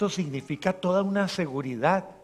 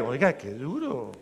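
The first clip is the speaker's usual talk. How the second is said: usual speaking voice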